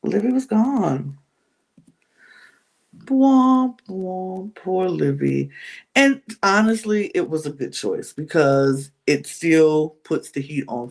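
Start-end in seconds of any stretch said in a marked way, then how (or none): 3.0-5.5 sad-trombone womps, falling away; mock sympathy. Narrator mourns the murdered Livy, mock sympathy. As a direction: play a mocking little lament.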